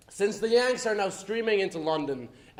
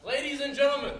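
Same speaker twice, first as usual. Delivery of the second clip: raised, higher voice to address the room; calling a crowd to order; 10 semitones higher, faster than usual. Acted. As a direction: project it to the whole hall.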